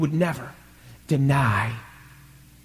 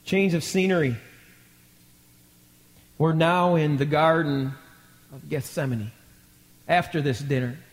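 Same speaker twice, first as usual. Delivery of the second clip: ordinary talk, nothing marked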